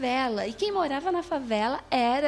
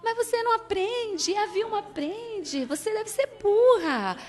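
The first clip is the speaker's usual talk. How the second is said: mother's scolding; 9 semitones higher, 4 dB louder than usual. Retold or imitated. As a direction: talk down to her like the mother did. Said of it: "Não, ela fala".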